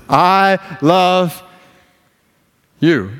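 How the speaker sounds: high, stretched-out proclamation; God declaring love. Acted. Preacher voicing God to the church, exultant. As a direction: proclaim it slowly, holding each word. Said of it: "He looks upon your life and says".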